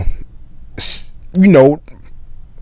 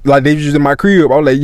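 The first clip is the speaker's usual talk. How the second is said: ordinary delivery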